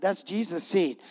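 usual speaking voice

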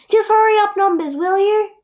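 high, loud outburst; impatient mock shout. Waiting speaker to timer numbers, impatient shout.

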